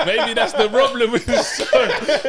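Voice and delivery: loud, high, helpless laughing speech; laughing loudly. Real, not acted. Co-host teasing that the show is missing an accent, laughing loudly. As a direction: shout it through bursts of laughter.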